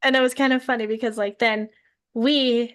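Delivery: usual speaking voice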